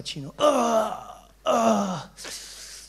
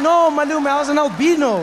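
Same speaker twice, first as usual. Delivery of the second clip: louder, higher insistent correction; 7 semitones higher, 7 dB louder than usual. Retold or imitated. Boy corrects his confused uncle, loud and insistent. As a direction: set him straight loudly.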